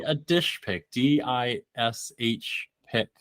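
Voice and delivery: "dish pic" said slowly and distinctly, DISH spelled out letter by letter; careful enunciation. Real, not acted. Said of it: "I say that too fast and it comes out all wrong".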